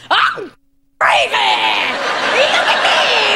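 hammed-up manic yell; mock crazy shouting. Comic imitating a patient first taking Ativan, mock crazed shout. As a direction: shout it like a patient gone wild. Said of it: "you're like".

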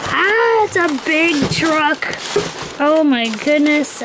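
high, drawn-out delighted squeal; excited high gasp, then stretched words; thrilled. Real, not acted. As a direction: squeal with real excitement at a new treasure. Unboxing a long-wished-for toy truck.

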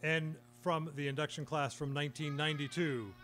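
announcer read. Real, not acted. Announces inductees by name.

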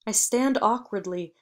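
plain reading voice

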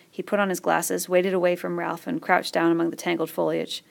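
plain reading voice

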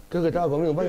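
ordinary talk

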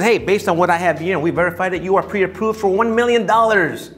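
acted lender, proudly proclaiming; enthusiastic lender. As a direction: announce the good news with gusto. Lender announces a pre-approval to buyer.

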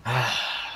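weary sigh. Acted. Player resigns in character.

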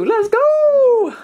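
high excited cheer of "Let's go"; hype shout. Real, not acted. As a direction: hype it up like a hype man. Hyping the parcel handover.

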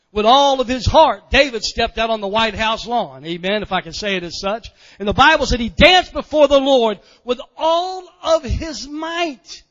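loud, high, drawn-out pulpit shout; fired-up preacher hammering each phrase; full-force preaching. Real, not acted. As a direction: proclaim it at the top of your voice. Preaching climax to the congregation.

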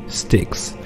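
plain reading voice

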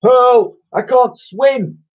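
very high, loud panicked plea; desperate high cry; crying for help. Acted. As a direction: yell as if drowning. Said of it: "the headmaster cried".

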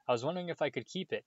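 fast, breathless rush. A narrator's reading. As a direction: blurt it out breathlessly. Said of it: "she said breathlessly".

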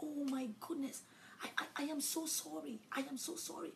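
high, soft pleading apology; small, high apologetic voice; mock apology. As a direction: imitate someone gushing sorry, softly and high. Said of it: "They come to you and they say".

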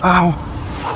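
usual speaking voice

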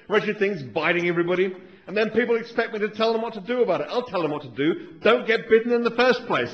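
a fussy, grumbling Australian scientist; Australian accent. Acted. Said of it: "my Australian accent isn't very good".

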